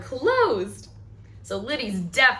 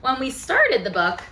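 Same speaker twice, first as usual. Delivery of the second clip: ordinary talk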